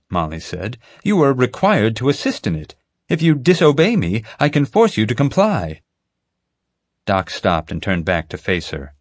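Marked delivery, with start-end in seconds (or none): 1.0-5.8 higher, rising stern warning; firm threat. A narrator's reading. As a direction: lean on him with authority. Agent threatens the vet.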